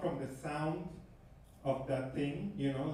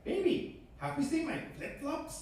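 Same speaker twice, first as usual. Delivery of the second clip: high sugary voice asking for flip-flops; mock sweet baby talk; 9 semitones higher than usual. To his girlfriend, a high mock-sweet question. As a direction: ask partner sweetly, voice way up. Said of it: "I have to be like".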